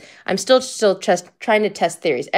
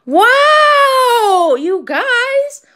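a real, much higher, louder cry of joy; ecstatic shout; 7 dB louder, much slower than usual. Real, not acted. Telling viewers about another big pull, real shout of joy. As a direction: cry out in thrilled disbelief.

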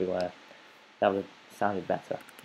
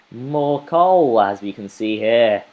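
excited shout; 9 dB louder, slower than usual. Real, not acted. Gamer finds more coal, thrilled loud shout. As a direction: shout the find out with glee.

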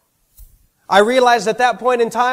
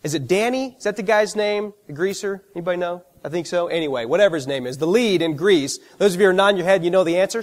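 ordinary talk

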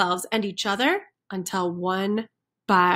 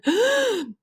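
dramatic gasp; 12 semitones higher than usual. A narrator's reading. Chickens under water.

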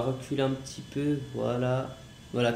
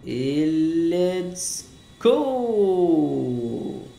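excited; 3 semitones higher, 5 dB louder, much slower than usual. Real, not acted. Takeoff cheer.

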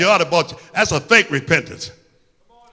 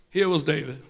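"Here was David" dropped much softer, hushed; 3 semitones lower, 9 dB quieter than usual. Shown as a demonstration. Preacher repeating the name, much softer. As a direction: let it fall away softly. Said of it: "repeated softer".

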